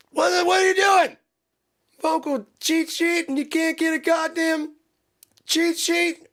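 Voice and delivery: high-pitched exasperated shout; mock angry yelling. Band member ranting about a cheat sheet, mock angry. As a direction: rant in a hammed-up high yell. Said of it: "I'm not mad".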